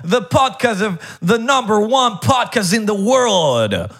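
big radio host welcome; announcer voice. Acted. Podcast intro announcer voice.

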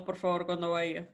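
ordinary delivery

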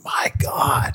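breathless whispered awe; whispered my God in amazement; awed whisper. Retold or imitated. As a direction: gasp it out under the breath. Retold awe at finisher.

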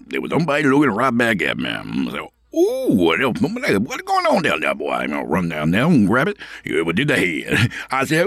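fast rambling backwoods hermit with squeaky outbursts. Acted. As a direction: babble like a half-crazy yurt dweller.

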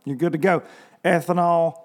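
ordinary delivery

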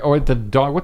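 ordinary delivery

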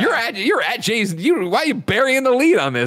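high, incredulous disbelief; mock outrage. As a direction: pretend to be shocked and betrayed.

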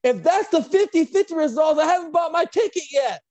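loud, high-pitched imitation of a yell; heckler's shout. Someone in the crowd interrupting the results, shouted heckle. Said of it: "someone in the crowd shouts".

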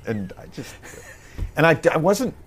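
usual speaking voice

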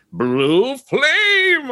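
high, loud heroic cry of blue flame; a big hammed-up battle cry; shouting his spell. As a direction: belt out the spell name like a triumphant hero. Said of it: "And he'd just be like".

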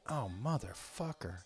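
muttered curse. Retold or imitated. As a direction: groan it under your breath. Realizing stairs were close.

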